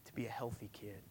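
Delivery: soft whispered ending. Acted. Pastor whispering tenderly to the congregation. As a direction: breathe the words out in a hush. Sermon drops to a hush.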